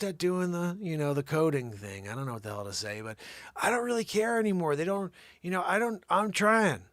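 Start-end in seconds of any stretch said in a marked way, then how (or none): none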